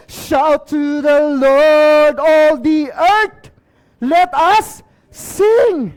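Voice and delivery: very slow, booming proclamation; preacher crying out the psalm at full voice; shouted scripture. Shown as a demonstration. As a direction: stretch each word out in a rousing shout. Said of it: "sabi dito sa Psalm ninety-eight verse four".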